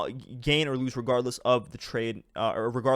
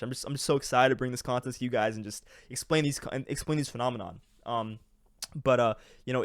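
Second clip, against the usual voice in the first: normal talking voice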